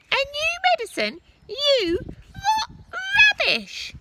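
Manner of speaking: put-on very high, loud scornful old woman; mocking grandma shrieking disbelief; Grandma yelling. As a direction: scoff at him from the roof. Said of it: "she yelled".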